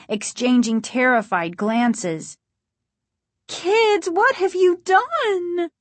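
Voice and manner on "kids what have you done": very high, drawn-out mother's cry; high, wailing disbelief; horrified cry. A narrator's reading. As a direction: cry out aghast, pitched way up. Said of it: "Missus Brewer cried".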